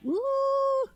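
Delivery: a sudden very high falsetto "ooh"; squealed ooh. Real, not acted. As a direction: squeal it like a giddy tease. Impressed squeal after boast.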